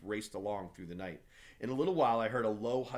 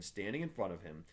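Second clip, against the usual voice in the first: ordinary delivery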